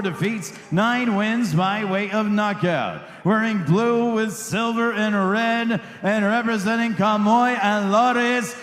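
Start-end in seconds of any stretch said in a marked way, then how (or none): none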